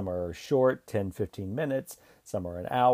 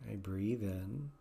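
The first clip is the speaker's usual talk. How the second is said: guided meditation; 7 dB quieter than usual. Acted. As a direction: ease them gently into calm and self-kindness. Leads a guided self-compassion meditation.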